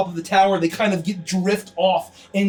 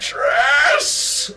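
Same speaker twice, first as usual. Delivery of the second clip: slow, far-off, higher roar that swells to a shout; booming demon voice; 4 semitones higher, much slower than usual. Acted. Demon lord roaring at Escher through the portals, booming. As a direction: let a demon lord's voice shake the portals. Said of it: "a booming voice interrupts".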